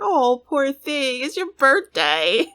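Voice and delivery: mock pity. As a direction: coo a fake consolation, slowly. Mock-comforting a Sim.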